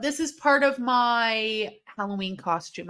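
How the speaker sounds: normal talking voice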